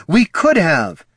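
high, loud, slow outburst; exclaiming. A narrator's reading. Loruna blurts to Catha, loud high exclamation. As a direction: blurt it before catching herself. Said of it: "exclaimed Loruna".

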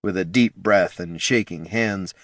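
usual speaking voice

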